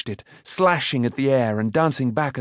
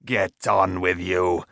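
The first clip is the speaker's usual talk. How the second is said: low, slow gruff dismissal; unmoved; 5 semitones lower, slower than usual. A narrator's reading. Smith waving away the boy's argument, unmoved. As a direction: brush him off low and slow. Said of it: "said Karl, unmoved".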